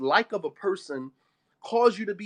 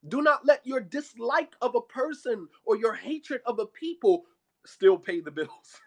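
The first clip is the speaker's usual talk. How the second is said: ordinary talk, nothing marked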